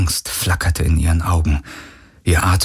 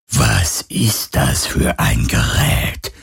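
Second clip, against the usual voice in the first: lower, slow whispering spirit; phantom voice; 7 semitones lower, much slower than usual. Acted. The phantom questions Galen about the device, whispered. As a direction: probe with eerie curiosity. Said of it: "fragte das Phantom".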